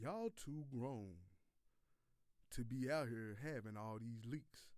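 slow, low drawn-out impression of his partner; imitating Boogie. Podcaster doing his partner Boogie's slow, low voice. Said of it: "this is exactly how he said it".

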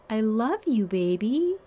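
a hint of a syrupy girlfriend; mock-sweet imitation of Willow; imitating Willow. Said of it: "she's just kind of like".

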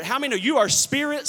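ordinary talk, nothing marked

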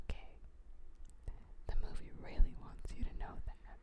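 hushed, fully whispered aside; mock whisper. Explaining the movie's moral to guys, mock whisper. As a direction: whisper it like a secret message.

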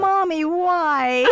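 put-on high, slow, wailing child voice; whiny child. Ending the freak-out line, whining slowly for mommy. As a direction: drag it out like a scared little kid. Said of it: "you have to end that sentence with".

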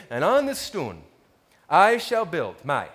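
put-on lightly Danish-accented Old English; slight Danish accent, reciting Old English. Shown as a demonstration. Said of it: "Old English was spoken with a Danish accent".

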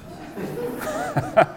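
a high, squeaky burst of laughter; high giggle. Real, not acted. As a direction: laugh at the silly reason, high and bright. Laughing at own joke.

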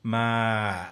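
ma ratcheting down into creak; creaky voice. Shown as a demonstration. Teacher demos creaky-voice ma to student. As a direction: let ma creak down at the end. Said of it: "I've got creaky voice".

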